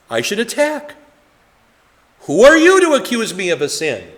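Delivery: mock defensive outburst. Voicing the sinner's defensive instinct.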